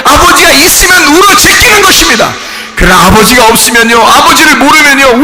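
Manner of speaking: impassioned sermon delivery; fervent preaching. Real, not acted. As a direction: build conviction line upon line. Preaches at full force.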